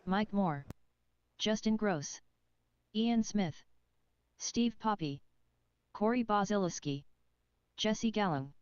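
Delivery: distant loudspeaker name call; PA announcer. Real, not acted. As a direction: read each name over the PA. PA announcer reading driver names.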